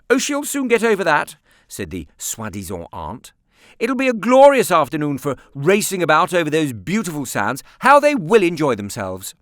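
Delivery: high, loud aunt voice; a grown-up brushing it off; bossy aunt. A narrator's reading. Aunt to Nicholas, loud and dismissive.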